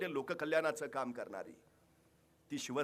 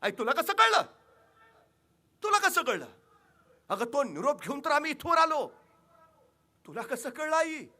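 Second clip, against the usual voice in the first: loud, higher, astonished questioning; startled king; 11 semitones higher, 9 dB louder than usual. Acted. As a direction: ask in shock how she knew. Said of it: "चमकले राजे". (चकित होऊन मोठ्याने विचारणे)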